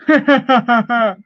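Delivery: cackling evil laugh; sinister villain cackle; wicked laugh. Retold or imitated. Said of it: "Wicked laugh".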